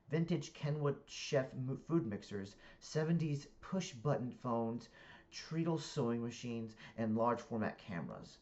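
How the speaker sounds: deliberate, projected book reading; story narration. A narrator's reading. Reader narrating a comic novel to listeners, animated narration.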